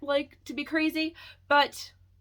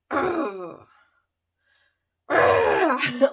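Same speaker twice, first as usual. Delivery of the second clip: a fed-up groan that swells louder; frustrated groan; 6 dB louder than usual. Real, not acted. Venting to viewers about clashing software, an exasperated groan.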